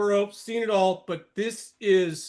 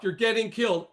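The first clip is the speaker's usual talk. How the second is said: usual speaking voice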